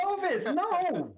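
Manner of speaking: high, drawn-out scolding cry; appalled protest. Acted. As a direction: stretch it out high in dismay. Horrified at friend's stabbing suggestion.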